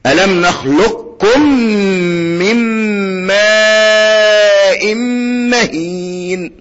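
slow chant, "نخلقكم" as nakhluk-kum with no qaf; Quran recitation, complete idgham. Shown as a demonstration. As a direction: recite with long held "ماء" and merged qaf in "نخلقكم". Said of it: "سمعت حاجة اسمها قاف".

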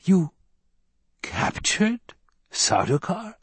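halting, incredulous question, rising high; stunned, word-by-word question; slow disbelief. A narrator's reading. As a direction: break it up slowly in disbelief. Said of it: "spoke slowly, disbelief in every word".

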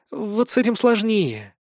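lightly voiced young elf girl; a hint of a dutiful elf girl. A narrator's reading. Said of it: "Валанта виновато опустила голову".

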